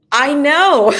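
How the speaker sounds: loud, high burst of "I know"; exclaimed, with a catch of laughter; emphatic agreement. Retold or imitated. Agreeing emphatically with coach's diagnosis.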